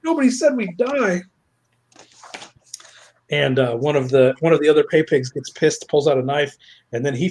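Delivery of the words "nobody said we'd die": high-pitched panicked outburst; mock freaked-out. Pay pig who just saw a killing, mock freaked out. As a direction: bail out in hammed-up terror.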